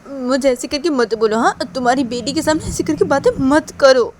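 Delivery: loud, insistent objection; protesting mother. Acted. Mother telling her accuser not to talk so before the daughter, loud protest. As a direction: push back hard, stressing the plea to stop.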